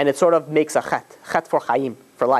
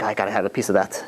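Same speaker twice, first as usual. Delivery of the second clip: craving murmur; 6 semitones lower, 4 dB quieter than usual. Retold or imitated.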